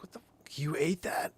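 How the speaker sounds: whispered disbelief at the dog; hushed shocked question. Retold or imitated. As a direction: hiss it out, stunned. Shocked at dog eating car.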